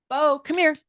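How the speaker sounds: high, carrying call to the dog. Real, not acted.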